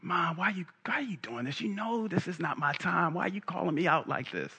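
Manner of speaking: hushed, put-upon son protesting to his mother; Jesus. Acted. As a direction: plead under your voice like an embarrassed son. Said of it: "Jesus talks to his mother".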